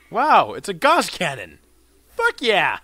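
loud, whooping excitement; thrilled. Real, not acted. Player discovering a Gauss cannon, loud thrilled cheer. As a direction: exclaim loudly at an exciting find.

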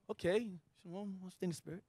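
softly puzzled, replaying the elder's words; confused. Retelling being confused by the elder, soft puzzled mumble. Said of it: "And I'm all confused".

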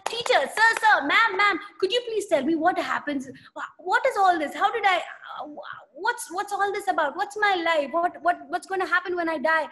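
put-on a boy bursting with questions; curious boy. Young Sundar begging his teachers to explain God, high eager pleading.

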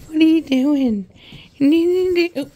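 ordinary talk

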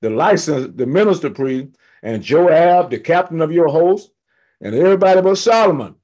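fired-up Bible-class preacher retelling scripture; fired-up preaching. Real, not acted. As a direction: preach the scripture with rising excitement. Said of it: "I get very fired up about this".

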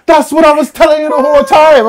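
high, loud exasperated put-on voice; mock exasperated customer.

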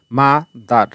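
"মা" and "দার" set apart by a pause; broken into syllables. Shown as a demonstration. Teacher repeating the split for learners, "মা" ... "দার" separated. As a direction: give "মা" its own beat, wait, add "দার". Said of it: "ব্যঙ্গে ব্যঙ্গে উচ্চারণ করতে হচ্ছে".

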